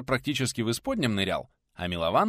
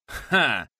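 loud, single burst of derision; snorted scoff; 3 semitones higher, 7 dB louder than usual. A narrator's reading. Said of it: "прыснул в ответ бородач".

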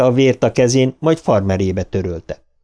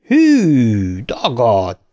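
jeering shout; much slower than usual. A narrator's reading. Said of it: "kiáltotta Harry".

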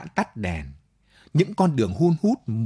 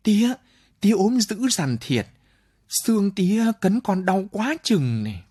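lightly voiced son, higher pitched; a son complaining his father is thin, higher; son calling out; 10 semitones higher than usual. A narrator's reading. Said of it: "Nó kêu lên".

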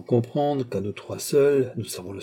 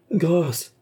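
supplication haute, à demi criée; cri suppliant; 6 semitones higher than usual. A narrator's reading. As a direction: implorer grâce sans hurler. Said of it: "cria encore le commandeur". (petit cri de détresse joué)

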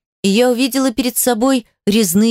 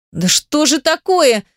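slow, higher exasperated cry; exasperated exclamation; 5 semitones higher, slower than usual. Acted. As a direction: throw up your hands. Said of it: "воскликнула".

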